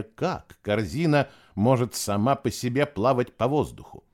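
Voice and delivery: usual speaking voice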